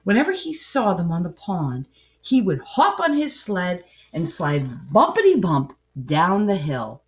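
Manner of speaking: plain reading voice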